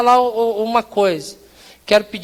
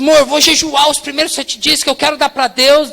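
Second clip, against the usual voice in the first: higher, louder retold announcement; raised, keen pitch to a loved one; eager announcement; 6 semitones higher, 6 dB louder than usual. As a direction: imitate yourself eagerly announcing a plan. Announcing his fast to wife.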